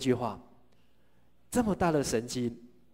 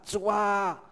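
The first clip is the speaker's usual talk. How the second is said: higher cry of a sinking man begging to be saved; desperate higher shout for rescue; crying out for help; 7 semitones higher, much slower than usual. Acted. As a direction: call out in panic. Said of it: "他就大喊".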